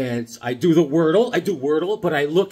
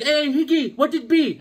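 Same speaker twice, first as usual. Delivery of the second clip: high put-on buddy greeting; Bobby's voice; 11 semitones higher than usual. Buddy greets him at the door, high and teasing. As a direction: pitch it up, teasing. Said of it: "He's like".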